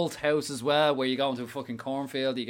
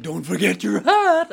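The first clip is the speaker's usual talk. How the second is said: slow, louder, higher teasing clown voice; mock clown taunt; 7 semitones higher, 9 dB louder, slower than usual. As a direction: taunt him after knocking off his hat. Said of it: "they were like".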